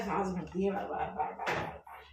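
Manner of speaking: breathless, fading to a whisper; gasping lightly between words; short of breath. Real, not acted. Unwell cook tells viewers she keeps losing breath, breathless. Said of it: "तबीयत खराब हो रही है ना".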